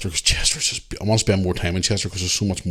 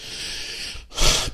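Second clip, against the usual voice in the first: weary sigh; 6 dB quieter than usual. Real, not acted. Annoyed about lost darts.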